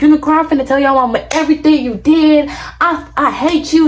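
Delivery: mad. Retold or imitated. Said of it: "And I was so mad".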